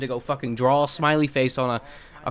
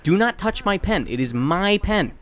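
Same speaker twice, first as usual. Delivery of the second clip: stern warning; 6 semitones higher than usual. Retold or imitated. Scolding coworker about pen.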